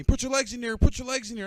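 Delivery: loud, high repeated command mid-fall; instructor screaming. Retold or imitated. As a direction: shout the order over the wind. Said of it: "He's screaming at me".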